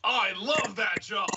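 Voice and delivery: playful burst of excitement; gushing enthusiasm. Pretend. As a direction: gush like an eager fan. Gushing over the job.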